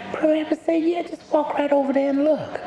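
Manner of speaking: very high-pitched trickster rabbit; wily Brer Rabbit, inviting. Acted. Brer Rabbit inviting the fox to look, high and coaxing. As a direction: send him over in a high rabbit voice.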